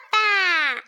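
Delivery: put-on loud happy child call; little one running back calling; calling papa. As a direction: shout for daddy. Child calls out.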